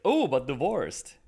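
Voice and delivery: mock-flirty, high swooping start, slow. Palm reader finding out he's divorced, mock-flirty. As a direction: savor the discovery with playful interest. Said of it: "It's a bit flirty".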